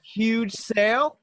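ad read. Pretend. Mock ad read.

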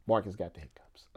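soft unvoiced whisper; hushed whisper over the sleepy dog. Real, not acted. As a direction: whisper it so the puppy stays calm.

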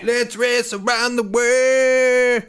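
big slow announcer intro. Pretend. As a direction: boom it out like a show opener. Show segment intro.

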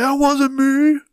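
high-pitched mock innocence; wife's denial imitated. Dad imitates wife blamed for fart, mock protesting. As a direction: deny it in a put-on high voice.

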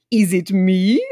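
mock gangster line. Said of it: "we hear the words".

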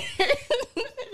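high-pitched burst of laughter; high giggle. Real, not acted. Remembering her parents' comment, high giggle. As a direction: crack up helplessly.

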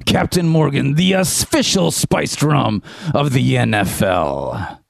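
hearty pirate sponsor read; Captain Squirrelbeard. Acted. Pirate captain urging fans to sub in the Captain, hearty pirate voice. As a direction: belt it out like a pirate, stretching the words. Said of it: "fun to do the pirate voice".

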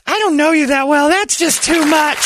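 hammed-up high voice of a put-off stranger; a woman at a bar backing away, in a mocking high voice; imitating the lady.

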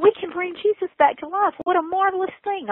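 high, wondering awe at a miracle; mock marvel. Telling a listener about cloning Jesus, high wondering awe. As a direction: gush in wide-eyed wonder.

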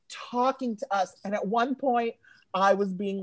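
normal talking voice

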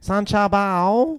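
hammed-up Chinese accent. Shown as a demonstration. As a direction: sing out the name slowly in a Chinese accent. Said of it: "It's a good Chinese accent".

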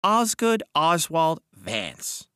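slow, high, measured announcement; reading aloud. A narrator's reading. Mister Wilde reads the ledger entry, slow reading aloud. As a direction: read the full name out slowly and formally. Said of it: "he read aloud".

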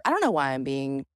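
ordinary delivery

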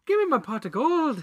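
high hammed-up stage Irish brogue; bad Irish accent. Joking about a movie mobster, bad Irish accent. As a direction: lay the fake Irish on thick. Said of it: "you're so good at that bad accent".

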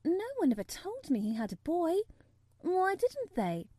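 put-on high girl's voice, drawing out the question; astonished young girl. A narrator's reading. Girl to newly found cousin, astonished.

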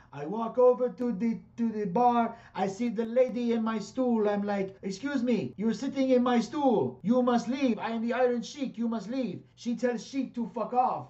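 high, loud broken-English Iranian accent impression; Iron Sheik accent. Retelling the Iron Sheik's bar story in his Iranian accent. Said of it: "But in his accent".

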